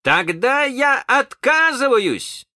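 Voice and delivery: high, drawn-out cry of refusal; defiant shout. Acted. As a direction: cry out the refusal slowly and boldly. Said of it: "крикнул он".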